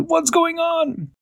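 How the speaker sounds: high, slow worried cat voice; Barnaby alarmed. Acted. Barnaby bursting into the room, high alarmed voice. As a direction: rush in worried. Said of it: "Barnaby said".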